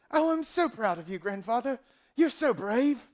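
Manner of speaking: put-on high, adoring grandchild in an affected accent; accented grandson. Grandson praising his grandfather, high gushing put-on accent. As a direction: gush with pride in a piping voice. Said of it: "I don't know why they have that accent".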